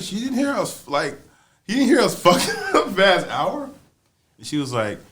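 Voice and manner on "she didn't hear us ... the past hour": high-pitched, incredulous question. Retold or imitated. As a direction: imitate disbelief, voice shooting up. Retold disbelief in closet.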